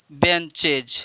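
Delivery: ordinary talk, nothing marked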